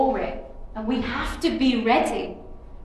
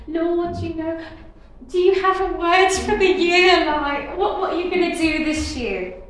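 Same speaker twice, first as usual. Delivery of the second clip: higher-pitched, mildly urgent retold prayer; lightly acted appeal to God, pitched up; eager pleading question; 6 semitones higher, 4 dB louder than usual. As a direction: put an eager question to the Lord.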